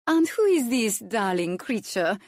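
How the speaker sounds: put-on gushing over-precious French accent. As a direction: coo it in a dainty French accent. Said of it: "overly precious French accent".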